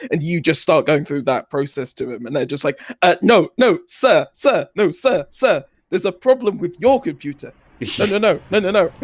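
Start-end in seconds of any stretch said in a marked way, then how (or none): 3.0-8.9 higher-pitched protesting phone scammer; flustered scammer. Retold or imitated. Scammer trying to stop a customer's questions, flustered. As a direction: insist in short, repeated bursts, pitched up.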